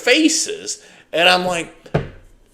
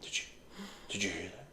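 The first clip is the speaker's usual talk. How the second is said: hushed nervous quote; 10 semitones lower, 28 dB quieter, much faster than usual. Retold or imitated. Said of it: "they're like".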